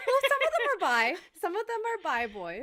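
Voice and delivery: high, laughing correction. Real, not acted. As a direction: laugh through the words.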